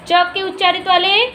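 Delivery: normal talking voice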